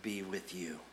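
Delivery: slow hushed blessing; gentle Jesus voice. Acted. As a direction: breathe peace onto frightened friends. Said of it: "and he said".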